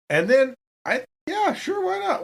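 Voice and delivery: high, giving-up shrug; oh whatever, why not; exasperated resignation. Real, not acted. Player gives up, exasperated.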